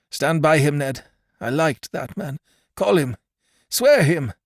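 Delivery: high, rising urgent demands; dying man calling for the Virginian; urgent dying plea. Acted. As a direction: demand it with the last strength. Dying man demanding Roberts sworn.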